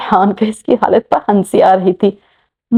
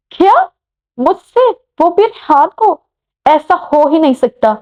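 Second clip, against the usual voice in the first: shocked disbelief; 7 semitones higher than usual. Acted. Anabia shocked at love news.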